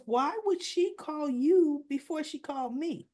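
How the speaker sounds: high, put-out questioning; indignant. Retold or imitated. Wife asks why daughter called him first, indignant.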